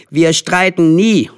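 put-on high, loud, slow child's protest; emphatic denial. A narrator's reading. Boys assuring their mothers, emphatic. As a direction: insist on it, stretching the words.

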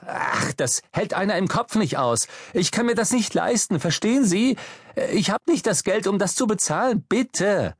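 high-pitched, exasperated begging; worked-up plea, voice climbing high; desperate pleading. Acted. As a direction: plead frantically to be let off. Desperate plea against a fine.

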